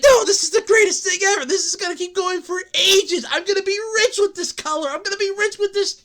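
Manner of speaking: fast, high smug investor; mock investor. Streamer mocking an NFT investor, fast high smug voice. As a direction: rattle it off like a deluded buyer. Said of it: "And it's like".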